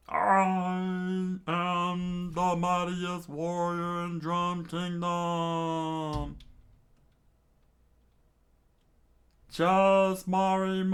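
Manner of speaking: high, slow pompous proclamation; hammed-up warrior voice; grand warrior intro. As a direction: announce yourself slowly. Warrior announcing himself.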